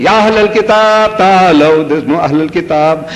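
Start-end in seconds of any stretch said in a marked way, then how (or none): 0.0-1.9 imams' calling. Retold or imitated. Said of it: "they repeating".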